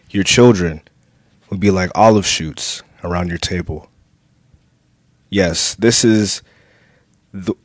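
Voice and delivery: measured, emphatic psalm reading with pauses between verses; scripture reading. Acted. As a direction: proclaim each verse to the congregation. Reads the psalm aloud.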